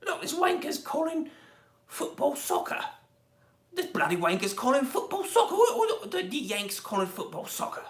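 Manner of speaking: high-pitched mock-indignant Cockney; exaggerated British accent. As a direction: grumble like a caricatured British football fan. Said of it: "don't get upset for my accents".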